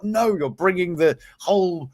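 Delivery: ordinary delivery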